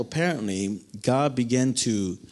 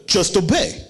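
a hint of the pastor, firm and a bit higher; Pastor Sam's motto; 5 semitones higher, 4 dB louder than usual. Youth pastor telling him to obey, lightly voiced. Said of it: "He would look at me and go".

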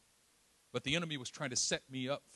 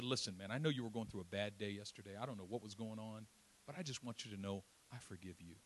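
softer, lower and tender; gentle forgiveness; 5 semitones lower, 9 dB quieter than usual. Retold or imitated. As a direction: quietly tell him he's forgiven.

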